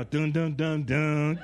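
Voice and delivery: loud sung-out piano thuds; imitated piano. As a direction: pound out dramatic piano notes by voice. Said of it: "Right with the piano".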